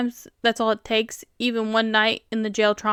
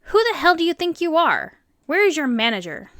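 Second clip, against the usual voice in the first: higher-pitched indignant outburst at store staff; indignant customer; 6 semitones higher than usual. A narrator's reading. Irate customer confronting store staff over the cheesecake, indignant. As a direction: demand answers like an irate customer.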